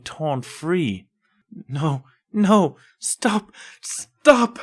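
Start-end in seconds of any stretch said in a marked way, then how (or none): 1.7-4.6 rising, louder desperate pleas to stop; whimpering plea. Acted. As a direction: beg the memories to stop. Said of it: "she whimpered".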